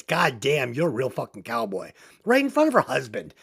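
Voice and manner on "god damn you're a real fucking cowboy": low, soft, impressed mock swoon; admiring. Sissy seeing Wes beside her husband, admiring. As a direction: quietly marvel at him, quick at the end. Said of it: "she sees Wes and she says".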